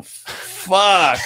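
high, drawn-out shocked curse; stunned swearing. Retold or imitated. Officer reacts to seeing the woman, stunned curse. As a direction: let the retold shock burst out.

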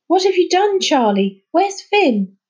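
high, loud frightened demand; alarmed cry. Acted. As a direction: cry out in alarm for the missing boy. Children panic at the harbour.

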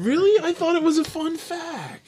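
high-pitched, hurt disbelief; wounded protest. Pretend. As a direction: squeak it out as if your feelings are hurt. Protests at criticism of question.

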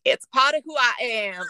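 high, loud mock Southern accent; put-on Southern accent. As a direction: defend yourself in a hammed-up Southern accent. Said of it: "Why are you doing a Southern accent?".

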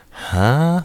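high, loud puzzled reaction; exaggerated questioning yelp; baffled. Acting out confusion at the plot.

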